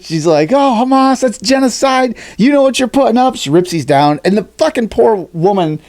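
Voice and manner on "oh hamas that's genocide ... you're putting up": high, preachy lightly voiced protester; mock self-righteous lady. Lady ripping flags lecturing the restaurant, high preachy mock voice.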